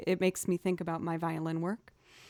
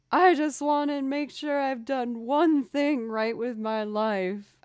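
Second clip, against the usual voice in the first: higher, louder mock-tearful plea; imitated crying plea; 6 semitones higher, 6 dB louder, slower than usual. Quoting a crying actor from a film ad, imitated tearful plea. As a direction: act out a tearful movie line, pitched up. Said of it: "he's crying and he's like".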